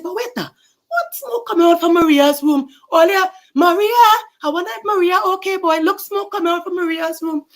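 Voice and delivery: high, loud worried neighbor; alarmed neighbor. Acted. Neighbor sees smoke from Maria's room, high alarmed cry.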